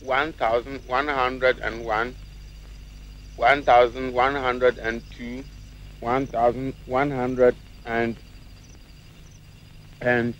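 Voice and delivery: drawn-out count, each number stretched out; slow counting. Acted. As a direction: stall for time with a slow count. Counting slowly, stalling for time.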